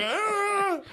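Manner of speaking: very high-pitched hysterical cry; hammed-up wordless shriek; mock wail. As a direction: squeal in helpless frustration. Acting out helpless hysterics in car.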